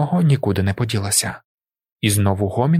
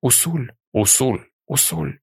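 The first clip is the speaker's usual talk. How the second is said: several lightly varied voices saying Усуль; different voices; 3 semitones higher than usual. A narrator's reading. Tribe members embrace Paul one by one, in differing voices. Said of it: "прислухаючись до різних голосів і тональностей".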